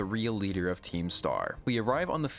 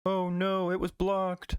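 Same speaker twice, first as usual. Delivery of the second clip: higher, hammed-up cartoon whine; mock dismay; 9 semitones higher, slower than usual. Pokemon whining that its attack got blocked, higher mock dismay. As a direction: whine like a defeated cartoon monster.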